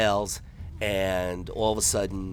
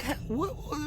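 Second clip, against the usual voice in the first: high, startled what; confused huh; 5 dB quieter than usual. Retold or imitated. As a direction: do a baffled double take. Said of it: "he's like".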